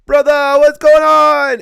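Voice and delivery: loud, high mock Jamaican accent greeting; hammed-up Jamaican accent, drawn out slowly. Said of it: "I might have slipped in a little Jamaican there".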